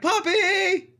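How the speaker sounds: very high, loud peppy squeal; peppy halfling's shrieked greeting; delighted squeal. Pretend. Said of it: "holds her hands out and she's like".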